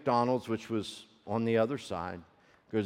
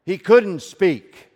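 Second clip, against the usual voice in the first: preaching climax; 7 semitones higher, 10 dB louder, slower than usual. Real, not acted.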